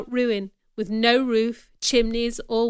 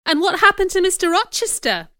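urgent; 8 semitones higher, 5 dB louder than usual. A narrator's reading. Urgent question after shock.

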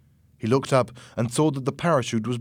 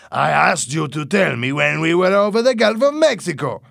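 loud, raised-pitch angry reproach; 6 semitones higher, 7 dB louder than usual. A narrator's reading. Boss to pilot who missed the Gulf, angry. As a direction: scold loudly, voice climbing high. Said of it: "angrily, pointing out of the window".

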